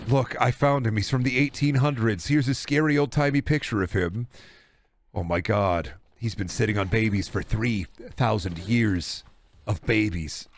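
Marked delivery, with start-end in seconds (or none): none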